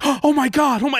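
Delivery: hammed-up shocked reaction; mock alarm. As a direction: act horrified that someone just fell. Acted panic at an imagined fall.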